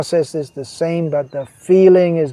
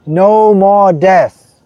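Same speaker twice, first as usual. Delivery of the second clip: No more death, slow and louder, each word weighed; slow emphatic declaration; 3 semitones higher, 8 dB louder, much slower than usual. Acted. Preacher proclaiming to devotees, slow and emphatic. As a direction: drag it out with conviction.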